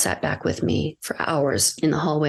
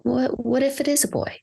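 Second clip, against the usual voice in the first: gentle question; 8 semitones higher than usual. Retold or imitated. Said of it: "And I said".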